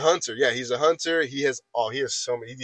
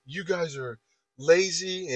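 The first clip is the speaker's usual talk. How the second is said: drawn-out, higher mock accusation; retold yelling; 5 semitones higher, slower than usual. Coworker accusing the two of them, mild mock yell. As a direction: imitate his angry accusation slowly, without shouting. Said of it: "yelling at this point".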